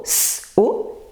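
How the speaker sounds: SO split into S, then O; SO sounded out robot-style; robot talk. Shown as a demonstration. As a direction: detach the sounds of SO, a small gap between. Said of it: "la redit en langage robot".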